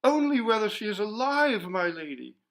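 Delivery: high and loud, close to weeping; frightened girl crying to her mistress; tearful. A narrator's reading. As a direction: plead through tears. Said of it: "said Georgiana with streaming eyes".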